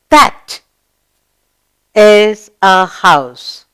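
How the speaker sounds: very slow, word by word; slow model reading. Shown as a demonstration. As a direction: drag each word so pupils can follow. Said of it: "Read this with, with me".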